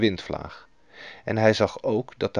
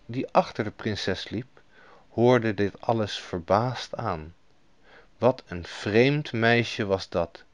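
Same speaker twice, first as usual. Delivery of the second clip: plain reading voice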